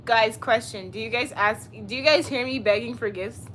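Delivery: usual speaking voice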